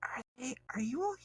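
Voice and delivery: hesitant and scared, barely audible; nervous young woman. Acted. Nervous NPC girl, GM voicing.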